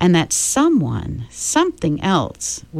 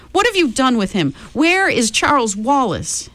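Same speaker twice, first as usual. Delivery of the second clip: frantic questions; 9 semitones higher than usual. A narrator's reading. Meg confronting the red-eyed man.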